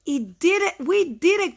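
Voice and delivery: high storybook cheer; high, swooping exclamation; excited cry. Acted. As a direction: cry out happily as Toad. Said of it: "cried Toad".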